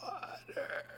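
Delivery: faint, raspy whispered plea. Retold or imitated. Patient who could barely talk asking for water, raspy voice. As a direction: barely get it out, soft and rasping. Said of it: "you'd ask in a raspy voice".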